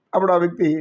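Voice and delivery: ordinary delivery, nothing marked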